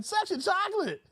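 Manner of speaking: high-pitched showman catchphrase; playful high-voiced impression; Randy Watson. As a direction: squeal the band name in falsetto. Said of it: "Randy Watson".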